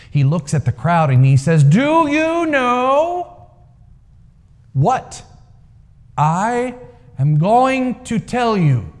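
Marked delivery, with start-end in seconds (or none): 1.6-8.9 high, slow, drawn-out booming question; the old man proclaiming, slow and grand; strong booming voice. A narrator's reading. Old man addressing the crowd from the rock, booming. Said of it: "with a very surprisingly strong voice".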